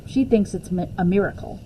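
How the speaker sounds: plain reading voice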